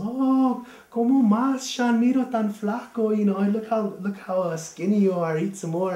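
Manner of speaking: put-on high and loud, fussing over a skinny boy; grandma urging the guest to eat; doting grandma. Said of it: "my grandma's".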